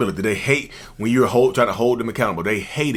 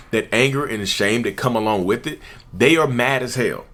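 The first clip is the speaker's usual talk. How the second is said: usual speaking voice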